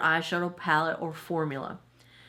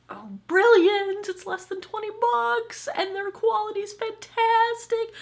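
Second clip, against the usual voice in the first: excited; slower than usual. Real, not acted. Raving about cheap dupe palettes.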